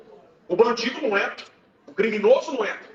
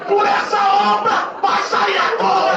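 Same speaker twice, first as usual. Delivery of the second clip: much higher and louder, shouting the testimony; preaching at full force; 9 semitones higher, 7 dB louder than usual. Acted. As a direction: drive the story home to the congregation, shouting. (pregação gritada com fervor)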